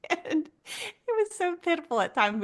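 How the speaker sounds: high, breaking voice, half laughing, half crying; can barely get it out through laughter. Real, not acted. Telling a friend about her son, laugh-crying.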